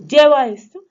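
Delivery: loud, high command; imperative order. Shown as a demonstration. Teacher showing students a sentence of command, loud imperative. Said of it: "de orden".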